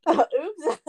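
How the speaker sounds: cracking up, loud and high; laughing. Real, not acted. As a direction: burst out laughing. Cracking up at the joke.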